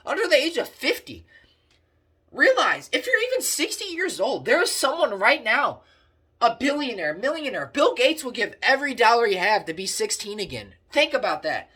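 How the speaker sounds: loud, high, fired-up preaching. Acted. Vlogger preaching to his viewers, loud and fired up. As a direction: pump them up like a street preacher.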